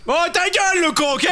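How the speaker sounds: high-pitched irritated snap; angry put-down. Acted. Actor silencing the village simpleton, angry and high. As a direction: shut him up, pitched well above normal.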